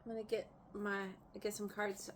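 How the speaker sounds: ordinary delivery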